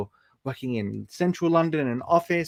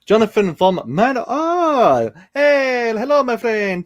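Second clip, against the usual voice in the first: high, loud delighted hello; excited greeting; 9 semitones higher, 6 dB louder, slower than usual. Real, not acted. Streamer greets a friend arriving in chat, delighted. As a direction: greet him with a burst of joy.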